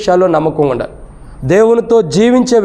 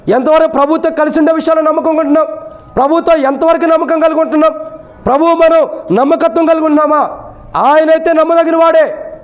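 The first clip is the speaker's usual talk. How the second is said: high-pitched, insistent sermon delivery; preaching; 10 semitones higher than usual. Real, not acted. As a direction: preach it in a raised, sustained voice.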